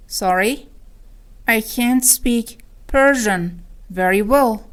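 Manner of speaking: slow, segmented teaching repeat; word by word. Shown as a demonstration. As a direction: spell out "I can't speak ... Persian ... very well" with pauses. (شمرده و با مکث)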